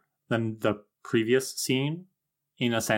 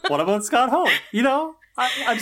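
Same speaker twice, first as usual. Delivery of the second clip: high, loud words through laughter; real laughter teasing the co-host; laughing tease; 13 semitones higher, 5 dB louder than usual. Real, not acted. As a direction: push the joke while cracking up.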